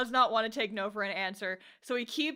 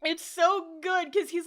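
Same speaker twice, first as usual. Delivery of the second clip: ordinary talk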